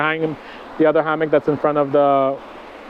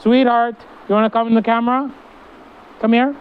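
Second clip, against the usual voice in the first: high, fast coaxing; high coaxing pet voice; 7 semitones higher, faster than usual. Real, not acted. Coaxing her cat Yumi toward the camera, high and sweet.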